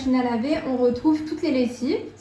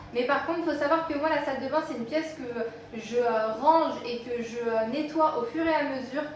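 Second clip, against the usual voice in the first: ordinary talk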